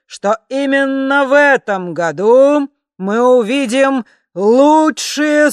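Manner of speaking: booming slow announcer, high and loud; thunderous voice. Acted. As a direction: boom it out like a ringmaster. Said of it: "громовой голос". (громовой голос конферансье)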